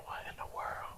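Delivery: bewildered whisper. Retold or imitated. Waking on the pulpit floor, whispering in confusion. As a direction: breathe it out in a hush. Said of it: "I said".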